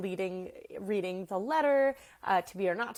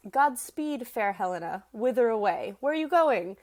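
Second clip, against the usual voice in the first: Hermia calling out; 3 semitones higher, 7 dB louder than usual. Acted. Hermia to Helena as she turns to leave, calling her back. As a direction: project it after someone walking off. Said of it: "Hermia calls her back".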